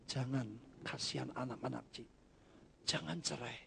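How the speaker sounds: soft, low pleading; gentle plea. Retold or imitated. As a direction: plead quietly with her not to divorce. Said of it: "hanya satu orang ini berkata".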